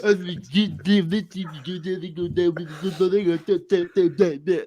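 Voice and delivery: high, garbled babbling coach impression; Lou Holtz. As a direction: babble it in a mush-mouthed caricature. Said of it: "Lou Holtz sounds like that".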